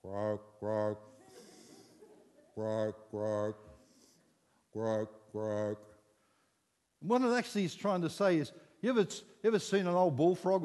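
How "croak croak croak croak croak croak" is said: low, soft froglike croak. Shown as a demonstration. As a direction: croak like a bullfrog. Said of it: "Here's this guy going".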